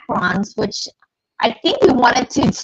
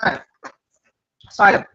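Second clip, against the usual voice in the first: talking with a mouth full; 4 semitones lower, much faster than usual. Real, not acted. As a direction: speak around a mouthful of bagel. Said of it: "stopping with my mouth full".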